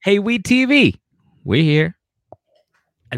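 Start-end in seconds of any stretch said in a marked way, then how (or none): 0.0-2.0 high, loud mock whoop; loud, high-pitched announcing yourself; hammed-up showing off. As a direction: call it out high and proud, imitating show-offs. Voicing the sisters showing off on TV.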